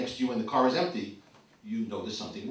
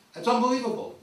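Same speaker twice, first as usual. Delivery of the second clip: high, loud incredulous exclamation; mock amazement; 13 semitones higher, 7 dB louder, faster than usual. Parent at kids' selective memory, mock amazement. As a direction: exclaim it high, playing up disbelief.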